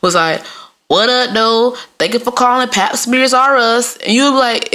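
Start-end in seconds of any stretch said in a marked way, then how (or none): 0.9-4.0 higher, casual mock receptionist; breezy un-switched work greeting; slangy receptionist. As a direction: answer the office phone in slang. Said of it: "what if you call Doctor Smith's office and the nurse".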